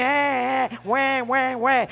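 put-on repeated "wah" like a baby in a cradle; badly faked baby cry; imitated baby crying. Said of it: "in the cradle, listening".

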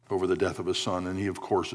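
normal talking voice

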